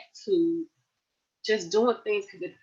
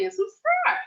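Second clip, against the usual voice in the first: sing-song. Real, not acted. YouTube sign-off.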